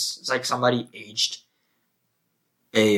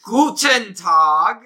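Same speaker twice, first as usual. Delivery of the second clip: slow, much higher and louder mock German greeting; German guy impression; 8 semitones higher, 8 dB louder, much slower than usual. Offering his German guy to his cohost, slow mock German. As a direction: bark out a cartoon German hello. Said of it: "you wanna hear my German guy?".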